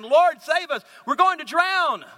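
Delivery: alarmed disciples. Acted. Disciples waking Jesus in the storm, alarmed cry.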